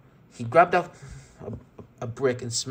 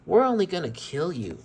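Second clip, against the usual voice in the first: creepy tone. Acted. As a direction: tease them with sick sweetness. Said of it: "in a creepy tone".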